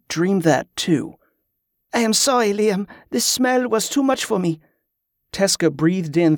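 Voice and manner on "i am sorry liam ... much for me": Yvette's voice. A narrator's reading. Yvette answers apologetically.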